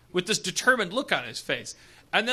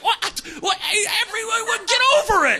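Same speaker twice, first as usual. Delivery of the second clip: high, baffled exasperation; perplexed; 12 semitones higher than usual. Retold or imitated. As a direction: act baffled that everyone still cares.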